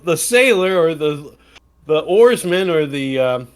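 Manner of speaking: ordinary talk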